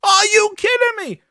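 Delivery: high, incredulous outburst; exasperated yell. Real, not acted. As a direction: shout it in disbelief. Ranting at developers.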